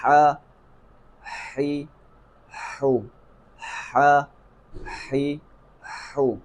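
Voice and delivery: ہا ہی ہو each said separately with a breathy throat h; vowel drill. Shown as a demonstration. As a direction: drill the three vowels on the throat h, one at a time. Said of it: "زبر زیر پیش لگا کے بھی مشق". (ہا ہی ہو الگ الگ مشق کے انداز میں)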